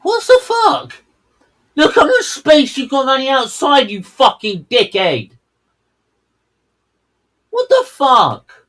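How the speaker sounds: high, loud furious rant; outraged shouting. Real, not acted. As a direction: yell at the driver in disbelief. Outraged at driver's wasted space.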